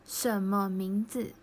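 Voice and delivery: 什么名字 said slowly, each syllable clear; slow clear Chinese. Shown as a demonstration. Teacher to Japanese learners, slow clear Chinese model. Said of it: "の後ろに".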